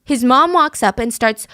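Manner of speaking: usual speaking voice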